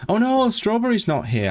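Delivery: high-pitched cry of disappointment; dismayed. Real, not acted. Dismay that a viewer is missing.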